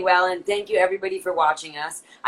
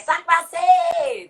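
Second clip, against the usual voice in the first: loud greeting; 12 semitones higher, 6 dB louder than usual. Retold or imitated. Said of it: "Don't be like".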